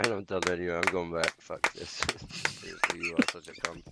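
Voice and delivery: quiet defeated mutter. Real, not acted. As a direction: grumble it under your breath. Muttering after game loss.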